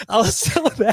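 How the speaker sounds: laughing through the words. Real, not acted. Telling a friend about the dog, laughing. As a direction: giggle while telling how mad you were. Laughing while retelling anger.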